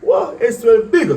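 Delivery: high, loud startled outburst; shocked disbelief. Retold or imitated. Artist finds a famous singer calling him, high startled shout. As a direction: blurt it out in shock.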